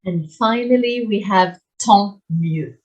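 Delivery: usual speaking voice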